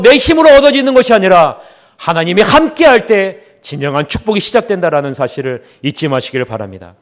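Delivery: normal talking voice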